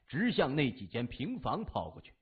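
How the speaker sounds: usual speaking voice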